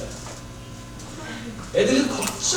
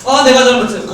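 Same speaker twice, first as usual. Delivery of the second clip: mock exasperated parent; 6 semitones higher, 6 dB louder than usual. Parent giving in to child.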